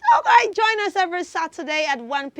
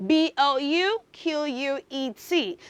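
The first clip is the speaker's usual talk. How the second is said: letters read slowly one by one; slow letter-by-letter spelling; slower than usual. Shown as a demonstration. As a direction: spell B O U Q U E T deliberately for kids. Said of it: "today is the word".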